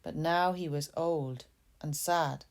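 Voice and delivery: plain reading voice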